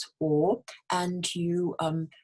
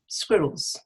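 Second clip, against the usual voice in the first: "Squirrels" with SQUI stressed, then falling; stress on first syllable. Shown as a demonstration. Teacher repeats squirrels, first syllable stressed. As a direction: lean on the opening of "Squirrels". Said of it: "the stress is on the squid".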